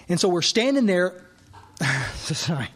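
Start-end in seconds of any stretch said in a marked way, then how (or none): 1.8-2.7 nervous laugh. Real, not acted. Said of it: "It always just freaks me out".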